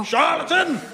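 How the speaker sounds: high outraged denunciation; outraged cry. Acted. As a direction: fling the insult at him.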